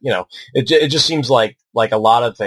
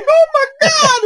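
hammed-up sobbing wail, much higher and louder; crying gangster; 4 dB louder, slower than usual. Tough gangster in the theater crying over Kirk's death, hammed-up high wail. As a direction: play a tough guy bawling in a squeaky high cry. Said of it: "he's, like, crying and shit".

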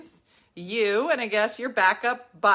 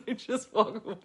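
usual speaking voice

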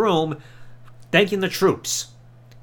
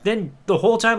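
ordinary talk, nothing marked

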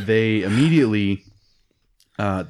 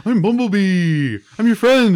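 much higher, louder cartoonish robot voice; mock Bumblebee voice; 10 semitones higher, 5 dB louder than usual. Host mocking Bumblebee's cheesy reveal to Optimus, high cartoon voice. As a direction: overdo a cheesy heartfelt reveal. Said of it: "it was just so".

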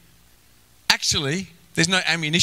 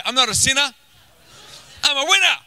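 congregation call-out; 9 semitones higher, faster than usual. Shown as a demonstration. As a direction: lead the room in a shouted declaration. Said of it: "Everyone say".

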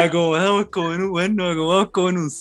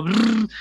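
lip-trilled burbling noise; imitated bubbling; 7 semitones higher than usual. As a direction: blow a bubbling brrr through the lips. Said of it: "pero como burbujeos".

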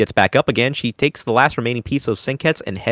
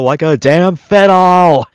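drawn out, louder and higher; emphatic punchline; 8 semitones higher, 7 dB louder, slower than usual. Real, not acted. Recapping the anime for viewers, emphatic punchline. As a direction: punch it loud and stretch it out.

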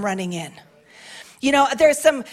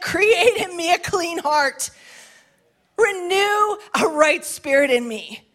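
slow, high pleading with a catch of tears; tearful prayer; 7 semitones higher, slower than usual. Acted. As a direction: plead like David weeping before the Lord. Voicing David weeping in prayer.

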